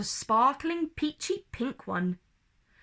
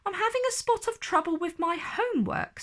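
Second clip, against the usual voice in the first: much higher, fast little fairy voice; Twinkle voice; 9 semitones higher, faster than usual. A narrator's reading. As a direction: rush it out sheepishly. Twinkle asks for help.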